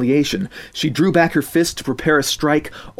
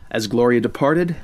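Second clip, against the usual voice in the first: plain reading voice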